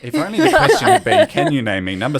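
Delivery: laughing through the joke. Real, not acted. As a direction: giggle while asking. Host jokes, laughing through quote.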